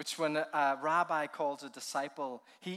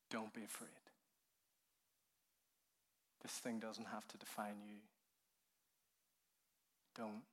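tender reassurance; 5 semitones lower, 13 dB quieter than usual. Acted. Jesus speaking amid the storm.

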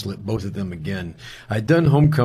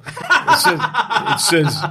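laughing while saying it, pitched up high; 11 semitones higher, much slower than usual. Real, not acted. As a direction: chuckle through the words, voice jumping up. Dramatic text message arrives.

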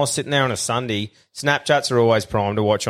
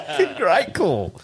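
laughing it out, higher and slow; 7 semitones higher, slower than usual. Real, not acted. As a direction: say the catchphrase again through real laughter. Repeats catchphrase while laughing.

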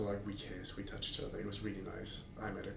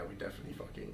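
whispering; 12 dB quieter, faster than usual. Retold or imitated. Her reply retold.

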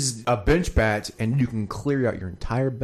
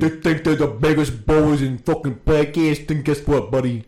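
a wobbly put-on Saint Louis accent that drifts; mock a rival podcast in a shaky Saint Louis accent; trash-talking rivals in a patchy Saint Louis accent; 4 dB louder than usual. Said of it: "What's that accent? It's all over the place".

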